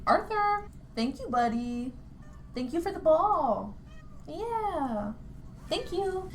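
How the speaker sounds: high-pitched, doting aside to a little buddy; talking to a pet. Real, not acted. Breaks off to thank Arthur, buddy.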